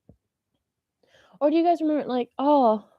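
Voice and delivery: ordinary talk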